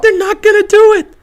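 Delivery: high, loud and fed up; exasperated shout. Real, not acted. Fan ranting at his team's coaches, exasperated. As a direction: throw it at the screen in frustration. Fan venting about play calling.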